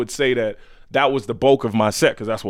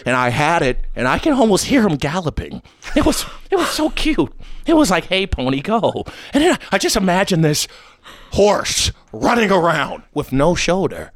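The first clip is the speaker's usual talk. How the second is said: high, sensitive villain voice; Joaquin Phoenix's Joker; 5 semitones higher than usual. Acted. Sensitive Joker pictures a galloping pony, high and fragile. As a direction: reminisce in a pitched-up fragile voice. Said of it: "Joaquin Phoenix in the Jo".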